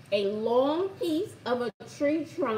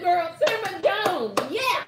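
ordinary delivery

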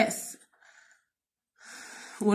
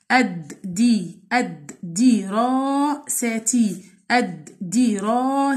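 slow syllables; much slower than usual. Shown as a demonstration. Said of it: "syllable demo".

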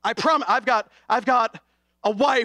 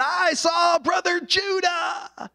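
puppet voice; 9 semitones higher, much slower than usual. Retold or imitated. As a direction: call it out to the crowd like a ventriloquist's puppet. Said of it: "had his puppet Gabby out".

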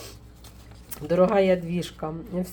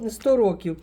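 ordinary delivery

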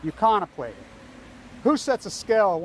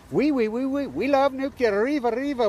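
high, loud mock French gushing; hammed-up French accent, high and loud; 9 semitones higher, 4 dB louder than usual. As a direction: squeal it like a mocking Frenchman. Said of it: "so it's".

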